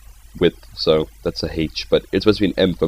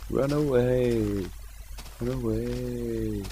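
soft, drawn-out distant wail; mock fleeing cry; 4 semitones higher, 10 dB quieter, much slower than usual. Spotted by the enemy, a hushed mock retreat. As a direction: stretch it out softly, like a tiny retreat call.

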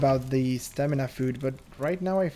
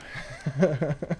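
real nervous giggle; nervous laugh. Real, not acted. Player kinda nervous in the game, nervous laughing. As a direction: laugh it off without knowing why. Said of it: "Yeah, I'm laughing right now".

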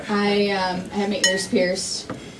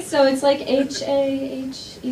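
ordinary talk

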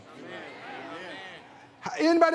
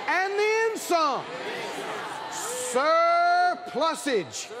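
higher, ringing preacher's declaration; full-force preaching; 5 semitones higher than usual. Acted. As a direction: proclaim it to rouse the congregation, stretching the final word.

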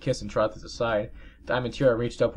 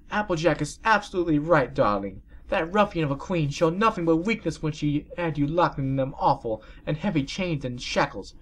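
aunt voice; 7 semitones higher than usual. Acted. Birdie voiced.

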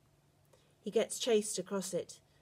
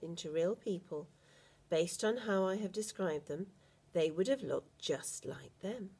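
ordinary delivery

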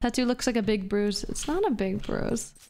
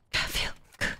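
unvoiced whisper, softer; whispered echo; 5 dB quieter than usual. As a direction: whisper it like a song's backing hook. Echoes the song Feel Good.

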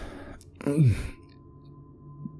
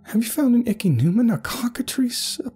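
high-pitched, scornful rant; a woman tearing into a madman's nonsense; mocking tirade. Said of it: "would continue her tirade".

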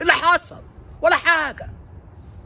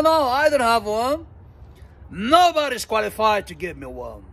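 Einstein's reply; 3 semitones higher, much slower than usual. Acted. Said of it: "قال له".

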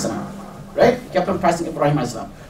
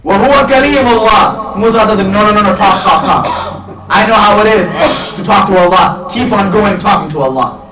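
raised, louder preacher's voice; impassioned preaching; 6 semitones higher, 6 dB louder than usual. Acted. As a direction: preach it with force, urging someone on. Preaches, voicing Musa's encouragement.

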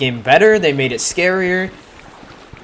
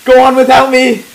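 panicking gamer; 12 semitones higher, 8 dB louder than usual. Real, not acted. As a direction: scream it as you flee. Chased by game monster.

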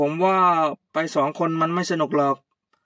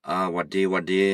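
put-on much lower, easygoing old man greeting; old uncle; 9 semitones lower than usual. Old uncle greeting Kaew, much lower. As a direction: drop low like a relaxed old uncle.

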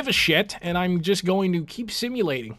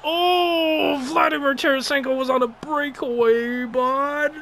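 high-pitched wail of frustration; outraged lament; 8 semitones higher than usual. Real, not acted. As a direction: cry out over the ruined chance. Outraged over the lost breakaway.

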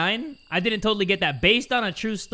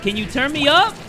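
higher-pitched, rising question; insistent request; 7 semitones higher than usual. Acted. Gamer asking to be turned up, insistent question. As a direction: ask insistently, lifting the end. First, milder take of repeated demand.

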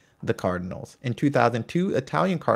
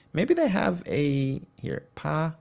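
ordinary talk, nothing marked

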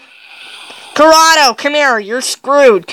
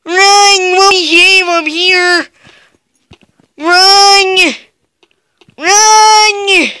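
shouting to run; 5 semitones higher, much slower than usual. Real, not acted. Calling out to teammates.

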